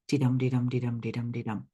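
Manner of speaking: chanted "de-dum", stress on each "dum", dying away; iambic pentameter beat. Shown as a demonstration. Teacher repeating the line's rhythm, metronomic "de-dum". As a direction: tap out "de-dum" five times, evenly. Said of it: "they follow that stress pattern".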